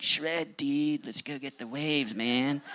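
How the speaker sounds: high, laid-back surfer dude with a laugh at the end. Retold or imitated. Young surfer calling his buddies to the waves, high and laid-back. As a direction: toss it off like a stoked surfer kid. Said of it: "surfer dude".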